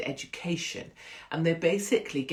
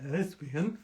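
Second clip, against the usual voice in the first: rug muncher impression; 3 dB quieter than usual. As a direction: garble the word as a crude impression. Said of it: "That was my impression of a rug muncher".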